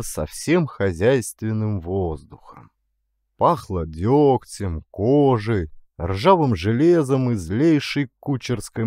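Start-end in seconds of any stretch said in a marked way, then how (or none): none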